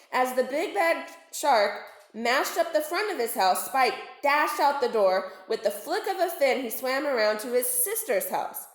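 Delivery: plain reading voice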